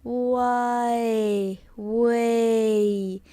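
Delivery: WAY drawn out, gliding through eh into a; long, slow WAY; slow demo. Shown as a demonstration. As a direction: stretch out each WAY slowly. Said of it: "Say really slowly".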